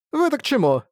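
high, feigned innocent squeak; innocent wide-eyed vampire. Acted. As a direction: play dumb, all innocence. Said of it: "состроил невинные глазки". (притворно невинный писк)

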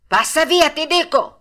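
fisherman's loud, high shout; insistent fisherman. A narrator's reading. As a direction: drive the dog off, insisting. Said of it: "gli ripeté il pescatore".

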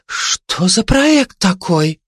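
high, drawn-out wondering; puzzled surprise. Acted. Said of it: "удивились листья". (удивлённо, с недоумением)

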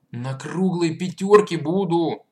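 loud, high, slow eager promise; delighted. Acted. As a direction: burst out in joy. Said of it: "в восторге пообещал Андрейка".

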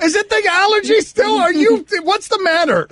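high, fussing mock worry; mock concern. Real, not acted. Mock worry about the cough.

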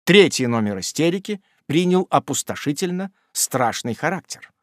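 usual speaking voice

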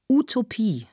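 high, loud cry with falling end; called out. A narrator's reading. Speaker calls out to his audience, loud cry. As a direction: call it out to a hall. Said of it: "rief der Redner".

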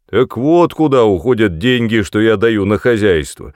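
loud grumbling husband. Acted. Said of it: "проворчал Броуди в тон матери".

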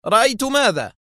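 very high, exasperated question; nervy exclamation. A narrator's reading. Director snapping back at Kwaleski, nervy. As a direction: throw the question back on edge. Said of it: "هتف المدير بدوره في عصبية".

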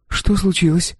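put-on high-pitched young woman's question; questioning girl. A narrator's reading. Girl to the mercenary who halts her, questioning. Girl's reply voiced by narrator.

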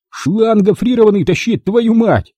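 high-pitched, exasperated bellow; swearing yell. A narrator's reading. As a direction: yell it, cross at the slowness. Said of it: "матерно заорал он".

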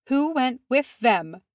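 high, slow, dreadful demand. A narrator's reading. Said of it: "said Mizam in a dreadful voice".

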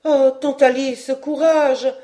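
put-on high, loud urging from a young woman; crying out. A narrator's reading. Niece embraces her aunt to encourage her, crying out. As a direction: exclaim it to hearten her. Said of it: "s'écria Hélène".

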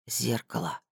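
slow, quiet and musing; thoughtful. Acted. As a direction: say it lost in thought. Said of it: "задумчиво произнёс он".

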